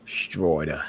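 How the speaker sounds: put-on low, soft echo of West Country accent. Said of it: "That West Country accent".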